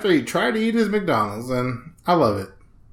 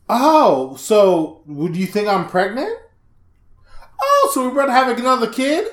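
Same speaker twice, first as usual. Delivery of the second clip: high-pitched, hammed-up realisation; put-on astonished questioning; mock surprise; 7 semitones higher, 4 dB louder than usual. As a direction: act out faked pregnancy news, voice lifted high. Acts fake pregnancy reveal.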